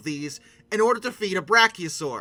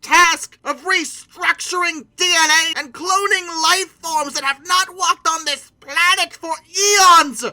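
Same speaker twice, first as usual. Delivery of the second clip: higher, louder, slow grandiose tirade; mock outraged rant; 7 semitones higher, 7 dB louder than usual. Park creator scolding ungrateful guests, hammed-up outraged rant. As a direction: rage at them like an offended god.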